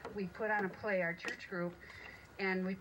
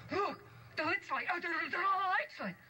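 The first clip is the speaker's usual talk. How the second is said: high, alarmed little dwarf; startled dwarf; 11 semitones higher than usual. Acted. Dwarf finding the cottage lit up, high and alarmed. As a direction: squeak it out as a frightened dwarf.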